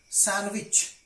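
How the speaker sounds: "sandwich" with n straight into w, no d; silent D in sandwich. Shown as a demonstration. Teacher shows learners "sandwich" said without d. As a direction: say "sandwich" as SAN-wich. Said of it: "not sandwich".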